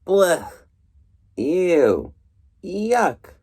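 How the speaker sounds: high, hammed-up yuck sounds; disgusted. Reader as Bruce grossed out by goslings, hammed-up disgust. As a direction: recoil in mock disgust.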